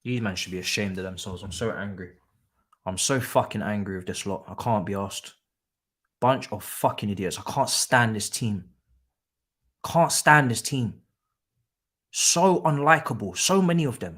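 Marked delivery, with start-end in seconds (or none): none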